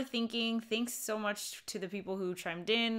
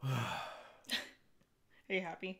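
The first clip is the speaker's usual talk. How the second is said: slow and sighing; 2 semitones lower, much slower than usual. Real, not acted. After a sigh of satisfaction.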